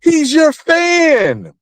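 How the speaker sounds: outraged disbelief. Retold or imitated. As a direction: scold her like she betrayed a fan. Outraged reaction to Remy.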